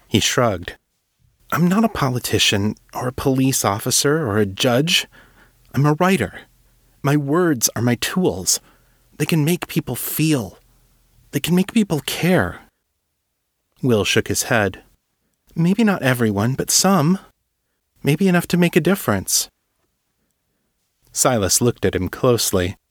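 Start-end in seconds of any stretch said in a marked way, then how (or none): 1.5-12.6 put-on higher, eager young man's voice, pleading; an earnest young writer begging to help; young Will, earnest. Will, passionate about words. 15.5-19.5 put-on higher, eager young man's voice, pleading; an earnest young writer begging to help; young Will, earnest. Will, hopeful.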